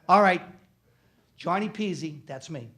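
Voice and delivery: ordinary talk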